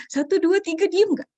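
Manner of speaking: high, loud mock countdown; mother's warning count. Mother counting at crying child, loud mock warning. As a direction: count like a fed-up mom issuing a threat. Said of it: "Nah, ibu hitung nih".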